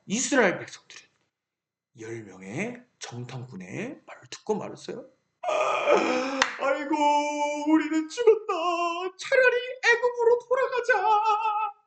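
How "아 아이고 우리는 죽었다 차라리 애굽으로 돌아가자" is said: high, wailing despair; mock lament. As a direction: wail that all is lost. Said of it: "하나님께 원망했어요".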